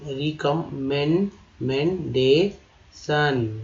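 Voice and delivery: drawn-out recommendation, each syllable spelled apart; recommendation stretched slowly syllable by syllable. Shown as a demonstration. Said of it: "देखिए ये हो गया सन".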